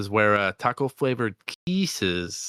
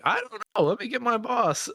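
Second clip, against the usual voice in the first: much higher, flustered clerk voice; hammed-up high teller voice; mock teller voice; 13 semitones higher than usual. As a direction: play a nervous teller passing the buck. Acted bank teller quote.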